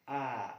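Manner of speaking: full open आ demo; open-mouth vowel. Shown as a demonstration. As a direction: open wide and say आ. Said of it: "पूरा मुंह खोल के करते हैं".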